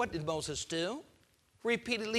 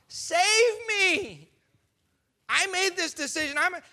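much higher, louder put-on voice; mock panicked plea; 10 semitones higher, 5 dB louder than usual. Preacher mocking Pharaoh begging to be saved. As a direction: squeal for rescue.

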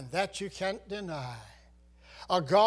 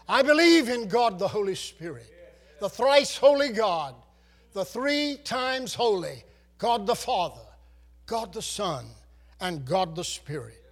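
bold creed declaration; 2 semitones higher, 5 dB louder than usual. Acted.

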